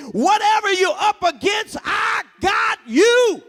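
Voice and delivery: high, loud, near-chanted cries; climactic preaching. Acted. Preacher cries out assurance to congregation, near chanting. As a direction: lift the church to its feet.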